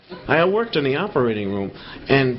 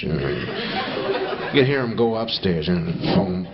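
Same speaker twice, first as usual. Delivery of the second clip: ordinary talk, nothing marked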